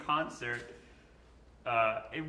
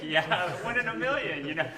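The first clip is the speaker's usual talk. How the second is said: sheepish laughing agreement; 5 semitones higher, 6 dB louder than usual. Retold or imitated. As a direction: laugh and admit it, higher. Laughing reply to teasing.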